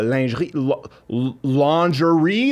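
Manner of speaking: slow careful "lingerie" sounded out with a French lilt. Shown as a demonstration. Host sounding out a French word for the guest, slow and careful. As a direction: stumble then stretch out "lingerie" slowly. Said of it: "So I'm like".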